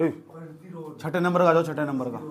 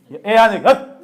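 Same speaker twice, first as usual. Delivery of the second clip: sharp shout; 3 semitones higher, 9 dB louder, slower than usual. Real, not acted. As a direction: bark it out to chase him away. Teacher shooing a student. (ऊँची, तेज़ डाँट)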